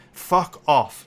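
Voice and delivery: loud, furious brush-off; "Fuck off" barked hard at the critics; angry fuck off. Real, not acted. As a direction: tell them where to go, loud. Snapping at online apologists.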